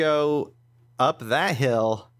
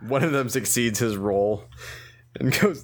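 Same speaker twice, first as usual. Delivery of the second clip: ordinary delivery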